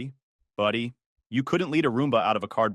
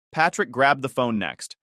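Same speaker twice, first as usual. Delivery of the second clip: plain reading voice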